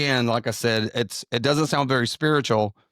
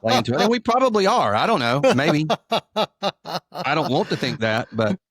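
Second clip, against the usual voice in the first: laughing; 5 semitones higher than usual. Real, not acted. Laughing at his own joke.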